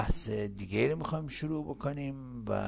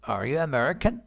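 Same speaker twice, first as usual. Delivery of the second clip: louder, higher question climbing on "American"; rising intonation; 4 semitones higher, 5 dB louder than usual. Shown as a demonstration. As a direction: send the voice up through "American". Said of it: "بنابراین صدا میره بالا".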